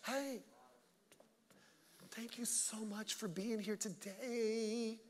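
very soft, slow, drawn-out greeting; hushed, exaggerated niceness; mock sweet greeter. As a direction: imitate a syrupy, wimpy church welcome. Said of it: "Guys, don't talk like that".

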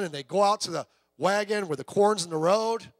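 ordinary delivery

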